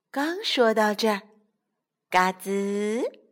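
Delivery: usual speaking voice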